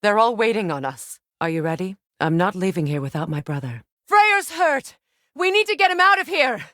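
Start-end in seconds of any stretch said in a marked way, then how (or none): 4.1-6.6 high, loud, fast alarmed cry; urgent shout. Acted. Freya to Kratos as her brother falls wounded, urgent shout. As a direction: yell for help, panicked for her brother.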